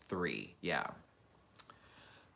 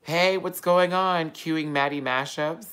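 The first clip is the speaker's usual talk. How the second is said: loud, higher, slow welcome; excited greeting; 7 semitones higher, 7 dB louder, slower than usual. Real, not acted. Welcoming a viewer joining the livestream, loud and cheerful. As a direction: greet a new viewer brightly. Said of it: "greeting live viewer".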